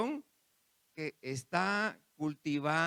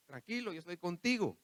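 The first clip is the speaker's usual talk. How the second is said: the Lord cheering someone on, pitched higher; the Lord speaking to a desperate believer, encouraging and higher-pitched; 6 semitones higher than usual. Acted. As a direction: urge a weary believer not to give up. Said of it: "ahí está el Señor con usted diciéndole".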